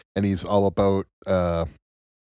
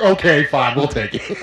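reluctant; 6 semitones higher, 5 dB louder than usual. Retold or imitated. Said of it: "They're like".

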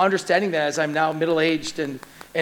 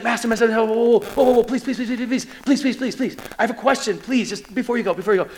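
frantic, higher-pitched pleading, words tumbling out; acted desperate urgency, repeating pleas; urgent intensity; 4 semitones higher, faster than usual. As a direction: beg like you ran up sweating to stop him. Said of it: "It was intensity. It was ferocity. He's running. He's sweating".

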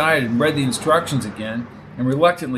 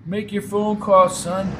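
put-on old man voice; 6 semitones higher, slower than usual. Old driver voiced in story.

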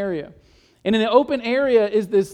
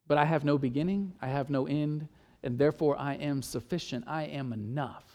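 hushed, building quieter; 6 semitones lower, 8 dB quieter than usual. Acted. Preacher speaking as God, fading to a hushed certainty. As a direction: voice dropping to near hush on each claim.

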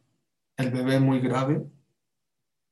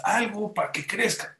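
pleading; 7 semitones higher than usual. Retold or imitated. Mother pleads with paediatrician.

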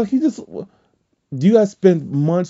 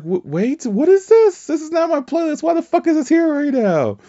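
startled disbelief. Retold or imitated. Partner caught off guard by a random song, startled. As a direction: blurt it out, half laughing in disbelief.

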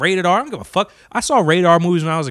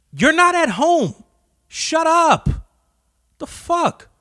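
pitched way up, slow and disbelieving; higher, dragged-out exasperation at her; talking back to a noisy moviegoer, higher and exasperated; exasperated outburst; 10 semitones higher, slower than usual. Retold or imitated.

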